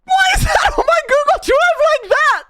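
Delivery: real mortified scream; horrified shout. Real, not acted. Horrified at clip on screen.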